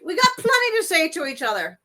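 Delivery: high, insistent movie dame; hammed-up old-film patter; Ma Kelly. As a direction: argue back in character. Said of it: "She's like".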